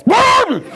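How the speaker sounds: high, loud revving burst; imitated engine roar. Son told to give it a little gas, loud roar. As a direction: explode like a car given too much gas.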